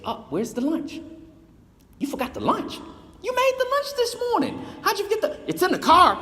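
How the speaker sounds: very high, squeaky mock outrage; high mock-scolding about the forgotten lunch; teasing exasperation. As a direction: rib him with exaggerated disbelief. Friends teasing over forgotten lunch.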